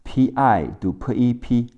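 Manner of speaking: usual speaking voice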